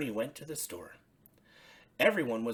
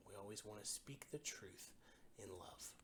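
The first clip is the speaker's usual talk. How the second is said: whisper; 3 semitones lower, 19 dB quieter than usual. A narrator's reading. Soft closing moral, unvoiced.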